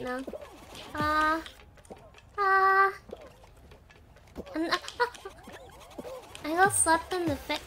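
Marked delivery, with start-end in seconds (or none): 4.8-6.4 unvoiced wheezing laugh; breathy laugh. Real, not acted. Slapped in the game.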